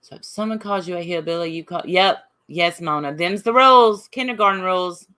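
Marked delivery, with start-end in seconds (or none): 3.1-5.1 much louder, emphatic proclamation; loud declaration. Real, not acted. To chat about the hillbilly insult, a loud declaration. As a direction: announce it like law.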